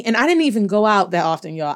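ordinary talk

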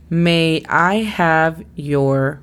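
very slow, word-by-word model reading; slow dictation. Shown as a demonstration. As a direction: dictate it slowly for a language learner. Teacher repeats exercise sentence to learner. (dictado muy lento, palabra por palabra)

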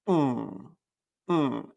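open-mouth hum vibrating in the throat; nasal ng hum. Shown as a demonstration. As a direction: hum the ng through the nose. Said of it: "sino que es".

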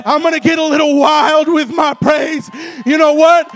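loud, driving revival sermon; full-force preaching. Real, not acted. Preacher urging the church to praise before the miracle, full-force preaching. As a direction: preach it hard, punching the ends of phrases.

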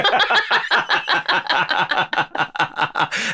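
long, high burst of real laughter. Real, not acted. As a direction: crack up at the joke. Cracking up at bailing joke.

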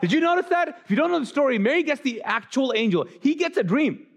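teasing outrage, pitched high; indignant. Real, not acted. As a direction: get worked up that he only got a dream. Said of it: "which ticks me off a little bit".

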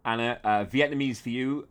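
ordinary delivery

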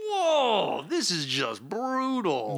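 high, drawn-out shocked whoa; as if wincing at a brutal blow; shocked gasp. Retold or imitated. Reacting to the brutal scene.

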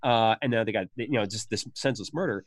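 ordinary talk, nothing marked

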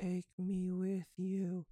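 put-on slow, eerie echoing child's voice; a tiny doll calling out; creepy child voice. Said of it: "you hear it echoed from another corner".

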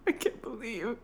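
soft, wavering hurt whimper; mock tearful betrayal. Wronged partner hearing his confession, mock weeping. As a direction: whimper as if silently weeping, hammed up. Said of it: "I was Robin Wright sitting there".

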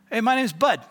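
called out loud and high; a bar regular hailing a stranger loudly; loud greeting. Retold or imitated. Old man introduces himself loudly.

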